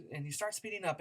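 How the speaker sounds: ordinary talk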